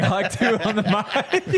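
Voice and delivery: mock announcer call. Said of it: "he's like".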